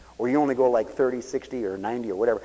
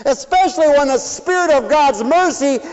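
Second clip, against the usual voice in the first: fervent preaching; 12 semitones higher, 10 dB louder than usual. Acted. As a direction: preach it out to the whole church. Preacher rising to full force.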